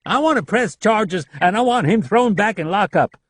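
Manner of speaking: insistent demand. A narrator's reading. Leland demands charges against Rapp, loud and insistent. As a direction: dig in and demand it.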